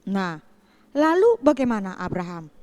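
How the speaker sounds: ordinary talk, nothing marked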